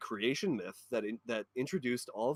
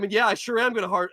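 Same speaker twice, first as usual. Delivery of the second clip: usual speaking voice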